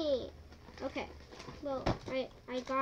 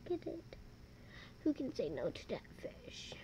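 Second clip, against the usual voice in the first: whispering; 2 semitones lower, 7 dB quieter than usual. Real, not acted. Whispered cooing over cute toy.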